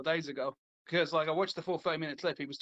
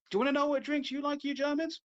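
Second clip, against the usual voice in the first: higher-pitched imitation of a comic; fed-up comedian; 8 semitones higher than usual. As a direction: challenge them as the fed-up comedian. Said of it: "So he says".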